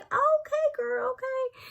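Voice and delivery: put-on very high, sing-song "Okay, girl, okay"; teasing hype. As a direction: tease a friend leaving with her boyfriend.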